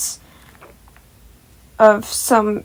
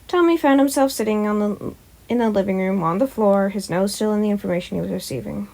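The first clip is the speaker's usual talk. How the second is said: usual speaking voice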